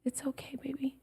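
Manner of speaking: soft whispered comfort; whispering. Pretend. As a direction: hush it like soothing a baby. Mock comforting a partner.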